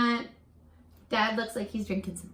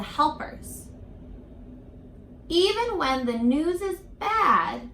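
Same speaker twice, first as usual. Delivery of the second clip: expressive read-aloud, slowing on key words; reading to children; 3 semitones higher, 3 dB louder than usual. A narrator's reading. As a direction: tell the hopeful part to the class. Teacher reads picture book aloud.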